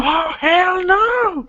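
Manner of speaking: high drawn-out gasp, hell no; mock outrage. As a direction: gasp then a slow shocked hell no. Mock outrage at being yelled at.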